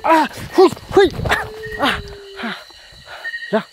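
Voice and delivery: loud fighting shouts; loud bursts at the spirit; shouted exclamations. Real, not acted. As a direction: shout to fend it off.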